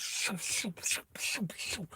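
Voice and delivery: soft whooshing bullet noises; quick hushed "shoo" bursts; imitated gunfire. Minigun bullets into the queen's mouth, soft whooshed sound effect.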